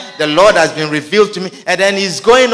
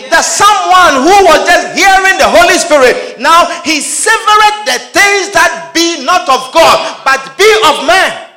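shouted preaching; 8 semitones higher, 9 dB louder than usual. Acted. Preacher shouting to the congregation, high and loud. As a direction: cry it out in disbelief.

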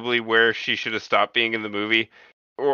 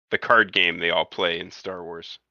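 ordinary delivery

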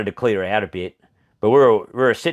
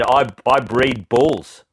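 slow deadpan; much slower than usual. Retold or imitated. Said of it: "And she said".

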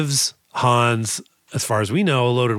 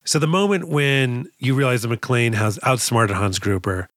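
usual speaking voice

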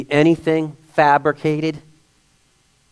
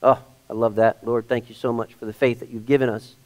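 soft, lower prayer of thanks; thankful prayer; 4 semitones lower, 4 dB quieter than usual. Real, not acted. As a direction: quietly thank the Lord, dropping the voice. Breaks into thanks to the Lord.

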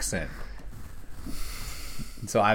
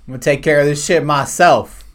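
loud, raised, emphatic declaration; mock defiant resolve; 4 semitones higher, 8 dB louder than usual. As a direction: declare it like a hammed-up avenger. Acting out movie character taking charge.

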